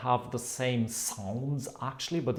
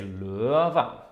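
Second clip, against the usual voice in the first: LØVE in Tromsø melody, LØ gliding up, VE a little lower; rising, then lower; 4 dB louder than usual. Shown as a demonstration. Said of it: "it goes up and then it stays a little lower".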